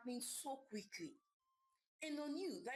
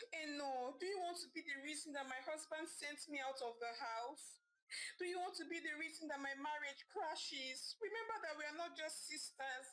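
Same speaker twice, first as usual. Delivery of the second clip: pleading; 6 semitones higher than usual. A narrator's reading. Wife begging her sister to speak to her husband, higher. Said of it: "pleading and begging with her sister".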